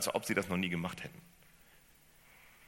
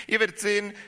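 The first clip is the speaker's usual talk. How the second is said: rufen; 14 semitones higher, 10 dB louder, faster than usual. Acted. Jesus calls to them.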